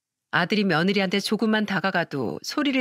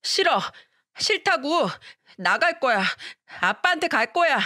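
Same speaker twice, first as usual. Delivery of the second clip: put-on a little boy screaming to go to his dad; a tantrum-throwing child's shrill refusal; 7 semitones higher than usual.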